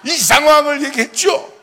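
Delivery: high, very loud mock-royal protest; 단종-style outburst. Preacher replaying his complaint to God, hammed-up shout. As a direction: shout it like a wronged young king. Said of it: "단종이야 하는 것처럼".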